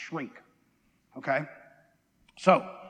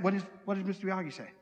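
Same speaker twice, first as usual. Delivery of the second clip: ordinary talk, nothing marked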